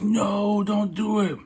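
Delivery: high-pitched mock panic; high mock plea. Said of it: "I was like".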